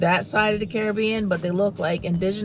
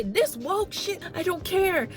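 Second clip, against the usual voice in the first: high sneering parody of anti-woke critics; mock the haters in a much higher put-on voice; 10 semitones higher, 4 dB quieter than usual. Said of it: "Y'all can get on and say".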